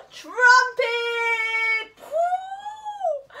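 high, sung trumpet call; trumpet blast. Acted. Elephant blasting the bun free, trumpeting. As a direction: trumpet it out with joy.

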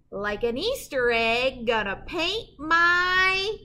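slow, drawn-out build to the rhyme; suspense pause. Acted. Reading to kids and pausing before the rhyme, drawn-out suspense. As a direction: leave them hanging before the rhyme.

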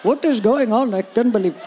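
mock Indian accent, indignant; put-on Indian accent, in disbelief. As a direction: imitate an outraged Indian passer-by. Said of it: "one random Indian dude".

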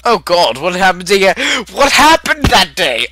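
high, loud cry of disbelief; alarmed outcry. Acted. Reacting to what happened that day, alarmed outcry. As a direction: exclaim it loudly, pitch climbing.

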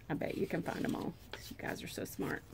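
quick whispered aside. Real, not acted. Teacher whispers praise to her young listeners, hushed.

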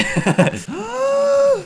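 shrill burst of laughter way up high; giggling high at the silly panda joke; high squeaky laugh. Real, not acted. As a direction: let a tiny squeal of laughter out.

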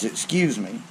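normal talking voice

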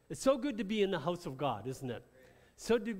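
ordinary talk

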